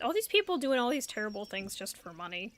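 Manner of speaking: ordinary talk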